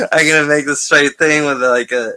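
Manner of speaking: loud, high, giddy rush; excited. Real, not acted. Excitedly loud about dressing up his son's set. Excited plan after big laugh.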